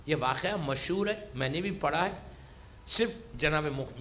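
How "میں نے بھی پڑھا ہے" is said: usual speaking voice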